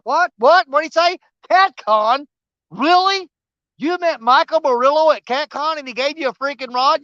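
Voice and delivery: high-pitched, incredulous questioning; mock astonishment. Voicing viewers amazed he got a rod, in mock disbelief.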